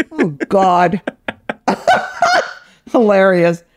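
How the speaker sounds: ordinary talk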